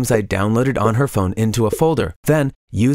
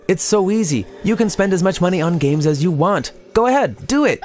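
a lightly voiced devil, rising higher; tempting devil; 6 semitones higher than usual. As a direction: coax like a little devil on the shoulder. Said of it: "The devil kept saying".

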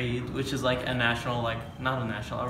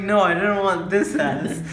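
higher, loud, drawn-out mock protest; retold childish complaint; 7 semitones higher, 6 dB louder than usual. Little cousin rejecting grandma's serving, mock complaining. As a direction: imitate a dissatisfied little kid.

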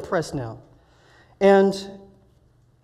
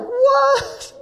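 high, loud, stunned "what"; excited disbelief; 7 dB louder than usual. Retold or imitated. Man startled by a friend's arrival while praying for him, excited disbelief. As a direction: yelp it in astonishment. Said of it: "What is he so excited".

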